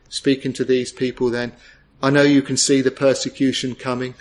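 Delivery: normal talking voice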